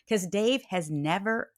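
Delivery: normal talking voice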